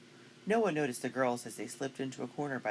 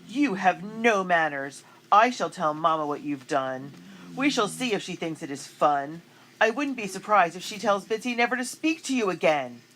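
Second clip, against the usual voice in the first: haughty threat; 5 semitones higher, 8 dB louder than usual. A narrator's reading. Annabelle threatening.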